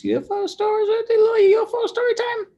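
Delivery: very high, loud excited squeal. Real, not acted. Telling viewers about UFO stories, squealing with excitement. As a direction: build from eager talk to a fast, loud squeal.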